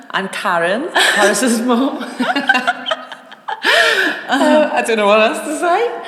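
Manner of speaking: giggly high laughing. Real, not acted. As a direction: laugh through the words shyly. Shy laughing self introduction.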